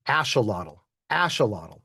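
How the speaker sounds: "Axolotl" with SH, ASH-uh-lah-tul; Spanish-style axolotl. Shown as a demonstration. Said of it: "what they will all say is".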